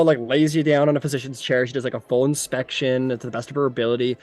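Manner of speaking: ordinary talk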